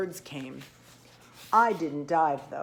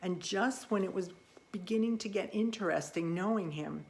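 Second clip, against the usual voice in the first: usual speaking voice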